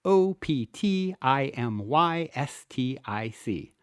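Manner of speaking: slow, each letter said apart: O P T I M Y S T I C; letters of O P T I M Y S T I C read slowly, one by one; spelled letter by letter. Shown as a demonstration. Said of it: "spelling out the letters".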